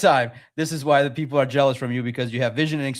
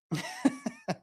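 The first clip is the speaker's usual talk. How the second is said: high squealing laugh; 9 dB quieter than usual. Real, not acted. Laughing at his own bit.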